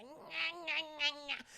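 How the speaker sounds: put-on high little baby-monster bleats; imitated creature cry, pitched high; newborn creature. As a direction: whine like a newborn alien. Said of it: "it's like a newborn baby".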